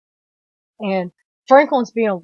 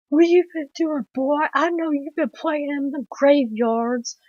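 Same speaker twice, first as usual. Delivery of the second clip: high put-on old man's scolding; scolding cook impression; 6 semitones higher than usual. Film fan acting the cook at the boy, high scolding voice. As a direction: play the movie cook telling off the boy, pitched high.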